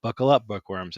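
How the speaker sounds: normal talking voice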